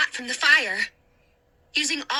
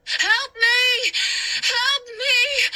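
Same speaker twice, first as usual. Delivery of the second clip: small frightened cry; 10 semitones higher, much slower than usual. A narrator's reading. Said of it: "voice sounded tiny and far away".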